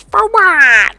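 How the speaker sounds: mocking wife's irritated reply; bitchy snap. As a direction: bite it off like a nagging wife. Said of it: "In this just bitchy, cunty".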